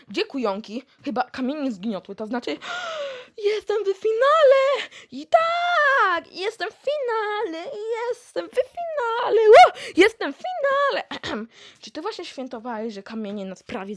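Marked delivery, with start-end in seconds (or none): 2.6-3.5 gasp. Acted. Gasps on reaching the final. 3.5-11.1 high, loud, over-the-moon cheering; ecstatic shouting. Acted. Contestant screaming with joy about reaching the final.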